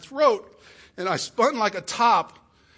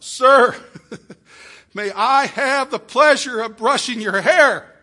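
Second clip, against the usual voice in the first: loud, slow, laughing blurt across the room; embarrassed loud shout; 5 semitones higher, 8 dB louder, slower than usual. Retold or imitated. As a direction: shout it so a deaf old man hears. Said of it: "I blurted out".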